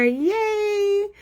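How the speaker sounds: high, drawn-out cheer; excited cheer. Real, not acted. Host welcoming people popping on, excited cheer.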